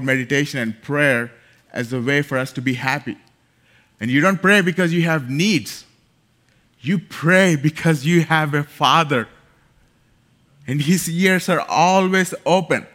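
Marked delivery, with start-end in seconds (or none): 4.0-12.9 rising sermon voice, louder on pray; forceful preaching. Acted. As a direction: preach it out to the congregation.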